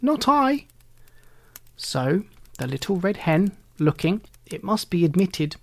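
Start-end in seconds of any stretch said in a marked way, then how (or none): 0.0-0.6 high squeaky rat voice. A narrator's reading. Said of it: "with a squeak".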